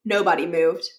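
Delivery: ordinary delivery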